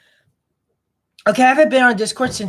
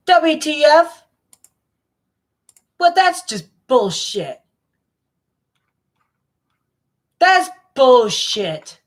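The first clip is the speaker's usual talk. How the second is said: higher and louder indignant outburst, slowing at the end; outraged; 8 semitones higher, 4 dB louder, slower than usual. Real, not acted. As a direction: protest loudly, stretching it out. Outraged at a private profile.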